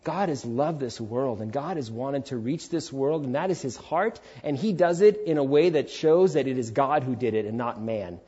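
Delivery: ordinary delivery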